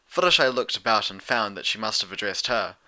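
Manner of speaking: usual speaking voice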